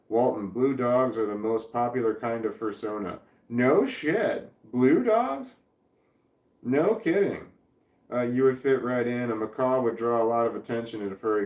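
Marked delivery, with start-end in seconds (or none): 3.5-7.5 high-pitched, swooping disbelief; astonished. Real, not acted. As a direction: shoot up high in amazed surprise. Reacts amazed to chat claim.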